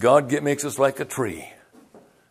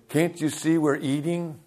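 slow, higher, soft-voiced mock indignation; mildly mad; 4 semitones higher, 6 dB quieter than usual. Man eating with family to preacher, mildly mad. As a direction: imitate an annoyed diner, drawn out and restrained. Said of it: "And some get mad".

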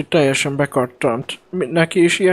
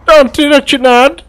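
high, loud plea to stop; frantic loud yell; panicked shout; 11 semitones higher, 11 dB louder than usual. Acted. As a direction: scream to hold him back. Friend yells to stop him.

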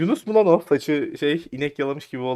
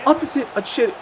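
higher stumbling cry, words tripping; tongue-tied excitement; 9 semitones higher, much faster than usual. Real, not acted. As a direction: stammer it out in the rush. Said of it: "Konuşamadım heyecandan".

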